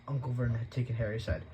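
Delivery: ordinary delivery, nothing marked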